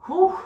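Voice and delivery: loud, high-pitched exertion whoop; high whew. Real, not acted. Trainer feeling the burn, loud high whew. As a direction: let out a big high whew.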